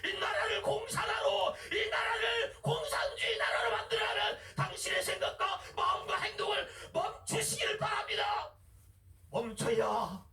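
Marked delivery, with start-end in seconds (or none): 0.1-8.6 full-force preaching, pitched high and sustained. Acted. Preacher summoning God's servants to speak up, fervent preaching. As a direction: call out to the congregation like a herald.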